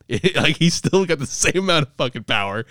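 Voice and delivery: normal talking voice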